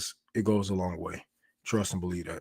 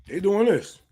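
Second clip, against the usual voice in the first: light impression of a guard chatting over food, higher; mock careless guard; 6 semitones higher, 4 dB louder than usual. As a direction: play the guard who doesn't care, eating. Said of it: "You know what the guard's doing?".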